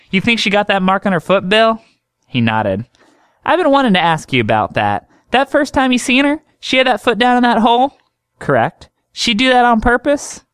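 high, chatty country doctor voice; high drawling woman doctor teasing a patient; folksy woman doctor. Acted.

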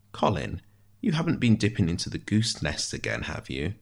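dry precise figure with big swoops in pitch; fussy wry prisoner. Acted. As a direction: savour each guess, musing and teasing. Ishmael inspects his breakfast by feel.

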